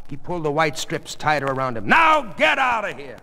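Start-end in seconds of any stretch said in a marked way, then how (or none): 1.9-3.2 high, loud command; angry order. Acted. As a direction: order her out at full voice. Father orders daughter out.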